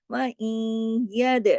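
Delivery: chanted, drawn-out reading; slow Quranic recitation. Shown as a demonstration. Teacher recites the verse slowly to show the merging. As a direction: let the nasal blend linger.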